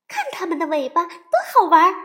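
fast, high and loud with delight; excited little girl. Acted. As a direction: squeal it out, thrilled. Sister bear delighted.

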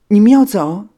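very high, loud startled question; urgent dismay. A narrator's reading. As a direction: react at once to stop them leaving. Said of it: "火影连忙挽留他们". (吃惊地急忙挽留)

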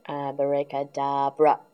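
plain reading voice

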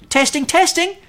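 bright squeaky cub voice; eager little bear. A narrator's reading. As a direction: squeeze the toy and test it.

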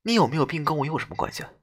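quiet fast cold female ghost; cold, complaining ghost. Acted. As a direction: toss it off coldly, a bit annoyed. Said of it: "她语气冰冷，似乎还带有一丝抱怨".

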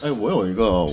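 ordinary talk

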